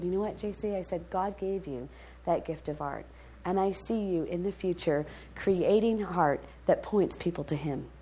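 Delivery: put-on soft, hushed and tender, speaking to a crying boy; gentle reassurance. To the weeping thirteen-year-old J C, hushed and tender. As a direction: lean in and comfort him quietly.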